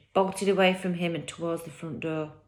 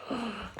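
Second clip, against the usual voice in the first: soft gasp; 5 semitones higher, 9 dB quieter than usual. Real, not acted. Reader reacts after chapter.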